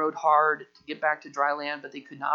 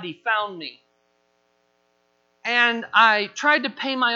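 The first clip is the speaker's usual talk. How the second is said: raised, emphatic testimony; impassioned testimony; 5 semitones higher, 5 dB louder than usual. Acted. Church member telling of fleeing God, impassioned. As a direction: build each attempt with loud, high emphasis.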